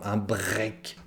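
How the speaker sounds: "un break" slow, with a French R, "bʀɛk"; rolled French R. Shown as a demonstration. Teacher showing learners the R in a loanword, slow and soft. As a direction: say "un break" slowly, leaning on the French R. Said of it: "on prononce les R".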